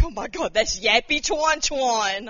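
slow, loud starstruck gush; drawn-out excited fan voice; fanboy gush. Retold or imitated. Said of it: "like, fanboy".

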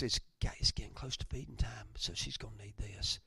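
soft whisper, a mother thinking aloud; whispered thought. Retold or imitated. Pastor voicing a mother's inner thoughts, whispered. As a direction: hush it like a private thought.

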